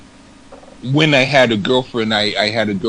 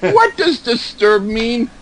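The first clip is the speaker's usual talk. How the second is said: put-on child voice; 10 semitones higher, slower than usual. Child voice asking father.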